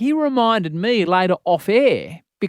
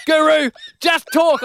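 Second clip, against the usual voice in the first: producer's order; 12 semitones higher, 4 dB louder, slower than usual. Retold or imitated. Producer voiced in story.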